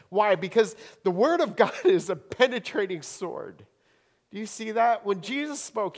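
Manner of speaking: fervent sermon delivery; preaching. Acted. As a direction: press the point home to the congregation. Impassioned preaching passage.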